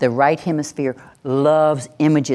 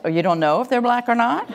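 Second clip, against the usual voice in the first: higher, quicker mocking query; mock puzzled; 8 semitones higher, faster than usual. Listener teasing the passive speaker, mock puzzled. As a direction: toss back a puzzled question, pitched up.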